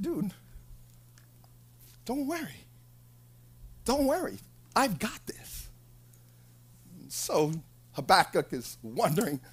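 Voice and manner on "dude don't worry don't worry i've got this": casual reassuring God. Acted. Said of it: "God said".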